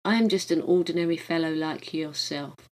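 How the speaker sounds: plain reading voice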